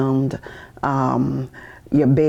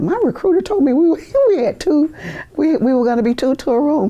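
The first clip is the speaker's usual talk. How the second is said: much higher, laughing protest; indignant protest; 12 semitones higher than usual. Retold or imitated. Young recruit protests to the dorm guard, much higher. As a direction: squeak it out in disbelief.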